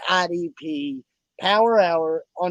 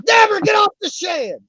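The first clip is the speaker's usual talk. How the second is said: the same hammed-up yell, pitched even higher; higher mock shout; 6 dB louder than usual. Host repeating his holler about the birds, higher mock shout. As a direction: holler it again, higher still.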